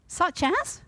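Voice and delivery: a high disbelieving query; doubtful, asking; incredulous question. Acted. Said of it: "she inquired".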